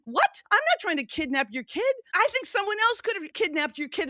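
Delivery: high-pitched indignant retort; replaying an outraged reply to the couple; indignant outrage. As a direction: fire it back, outraged at the accusation. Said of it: "I'm so enraged with these people".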